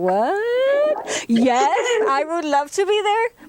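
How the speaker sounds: mildly hammed-up stunned acceptance; reenacted flustered excitement; super excited. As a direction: act out delighted disbelief. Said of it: "I was super excited".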